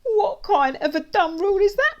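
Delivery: high, loud, indignant bully; angry outburst. A narrator's reading. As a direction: spit it out angrily at the top of your voice. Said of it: "angrily spraying my face".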